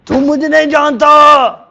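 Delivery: angry general. Retold or imitated. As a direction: snap it like an offended general. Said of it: "He got really angry".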